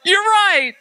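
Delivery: very high mock-astonished cry; amazed agreement. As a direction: exclaim it high, as if just realizing. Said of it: "And I go".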